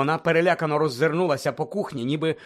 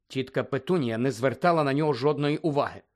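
ordinary delivery

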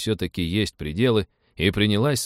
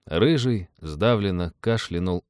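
plain reading voice

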